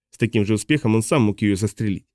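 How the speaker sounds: ordinary delivery, nothing marked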